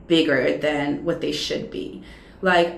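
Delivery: normal talking voice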